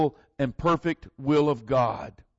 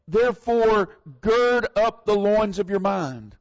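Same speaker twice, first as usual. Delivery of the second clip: scripture reading; 3 semitones higher, 5 dB louder than usual. Acted. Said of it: "And he says".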